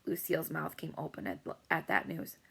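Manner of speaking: ordinary delivery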